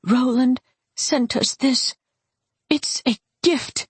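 high, distressed, halting bitterness; bitterly spat out. A narrator's reading. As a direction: spit out the gift like poison. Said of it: "She spat the word out as if it were poison".

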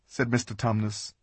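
usual speaking voice

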